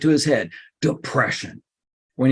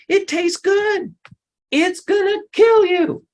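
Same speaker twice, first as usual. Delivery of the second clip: much higher, slow, squeaky mock voice; helium voice; slower than usual. Mocking the diet's first happy days: high helium-style squeak. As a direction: squeak it out like you breathed helium. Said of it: "it makes your voice go up".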